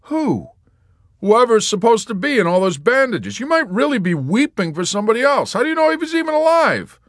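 high, loud, fast needling; Yossarian. A narrator's reading. Yossarian badgering the nurse, high and fast. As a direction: press her with a pile of questions.

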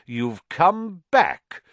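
angry nobleman threatening the headmaster; furious Lucius. A narrator's reading. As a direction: spit it at the headmaster. Lucius confronts Dumbledore.

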